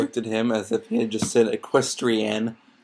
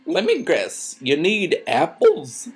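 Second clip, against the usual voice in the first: higher, teasing character voice; joking; 6 semitones higher, slower than usual. A narrator's reading. Applejack guessing what her friend needs, joking. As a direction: draw out the question playfully. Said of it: "Applejack said jokingly".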